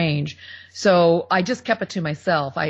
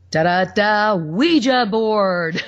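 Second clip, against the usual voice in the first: slow, mock-ominous Ouija board; spooky; 3 semitones higher, 3 dB louder, much slower than usual. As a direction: say Ouija board like a scary movie. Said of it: "I say that kind of funny".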